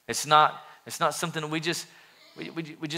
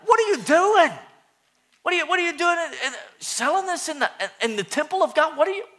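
outraged Jesus; 12 semitones higher, faster than usual. Retold or imitated. Jesus confronting the merchants, high and indignant. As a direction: cry out high, scandalized. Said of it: "he had a little bit of passion".

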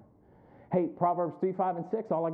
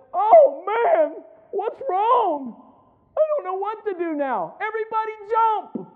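high, loud hammed-up wail; shrieking comic alarm, far above usual pitch; mock panic; 10 dB louder, slower than usual. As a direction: cry out in exaggerated helpless panic. Mock panicking Paul on the ship.

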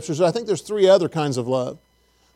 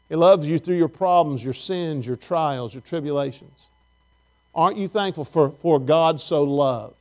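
normal talking voice

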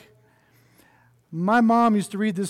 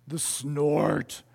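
lower, weighty naming of the snort; ominous name; 4 semitones lower, 4 dB quieter than usual. Acted. Preacher introducing the snort to the kids' story, low and weighty. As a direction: say it like a looming machine.

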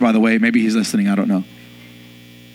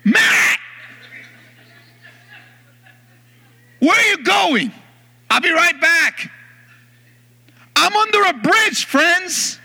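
calling out in alarm; 13 semitones higher, slower than usual. Retold or imitated. Stranded under bridge, calling out.

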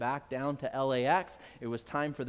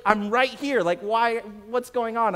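irritated; 5 semitones higher, 5 dB louder than usual. Retold or imitated. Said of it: "I'm just irritated".

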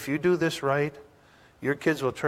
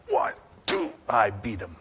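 a hint of a parent counting swats; mock counting parent; 4 semitones lower than usual. Preacher voicing a parent counting swats, mildly acted.

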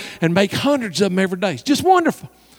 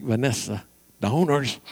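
hushed reproof; 8 semitones lower, 9 dB quieter, much slower than usual. Retold or imitated. Embarrassed pastor hushes her.

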